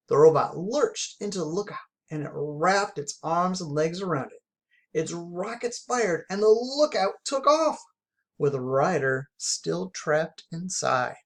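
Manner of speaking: usual speaking voice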